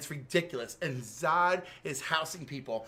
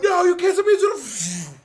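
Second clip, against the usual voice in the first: very high, very loud laughing protest; panicked shout; 13 semitones higher, 13 dB louder than usual. Retold or imitated. Hank Henschel protesting to the Eradicator, high laughing shout. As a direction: scream it in desperate disbelief. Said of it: "And Hank Henschel's like".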